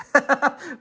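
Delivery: short high giggle; quick laugh at your own joke; real high-pitched chuckle. Real, not acted. Laughs at own bad impression.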